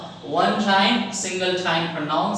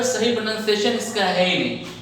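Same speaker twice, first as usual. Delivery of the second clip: usual speaking voice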